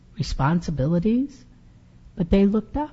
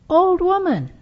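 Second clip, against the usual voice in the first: put-on higher, light boy calling out; 11 semitones higher than usual. Boy leading a camel calls to Befana, higher eager voice. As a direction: call to her like an eager boy. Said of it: "called a boy".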